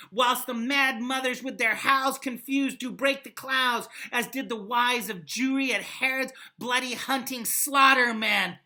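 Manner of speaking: loud, declaiming Shakespeare read; dramatic recitation. Acted. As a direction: proclaim it like a king at the gates. Reads speech aloud.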